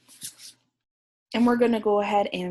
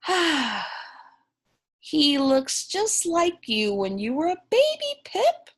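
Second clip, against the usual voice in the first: lightly voiced mother penguin, higher; tender mama; 7 semitones higher than usual. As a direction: speak fondly to your child. Mama speaks tenderly to Pip.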